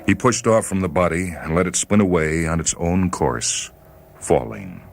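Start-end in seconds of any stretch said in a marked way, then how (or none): none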